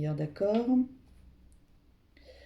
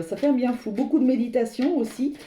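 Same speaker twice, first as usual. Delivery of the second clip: normal talking voice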